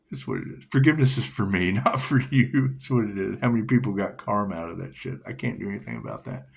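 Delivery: normal talking voice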